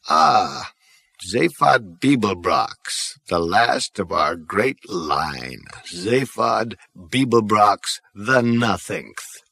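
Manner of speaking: put-on slow, frail old ghost voice; ancient great-grandfather. Great-grandfather judging Zaphod, slow and frail. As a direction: drawl it out, weary and ancient.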